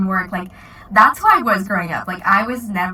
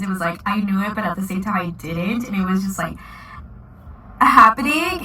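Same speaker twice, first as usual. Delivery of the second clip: ordinary talk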